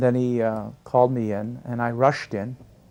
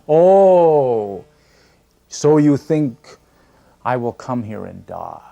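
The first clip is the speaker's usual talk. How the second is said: mock surprise; 8 dB louder, much slower than usual. Guru retorting with mock surprise.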